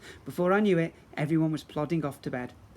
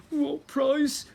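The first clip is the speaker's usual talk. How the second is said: high, whiny goblin prince; 11 semitones higher, 6 dB louder than usual. Acted. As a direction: squeak it out like a spoiled prince. Grogber character voice.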